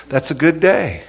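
ordinary delivery

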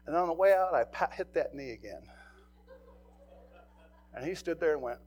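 ordinary delivery

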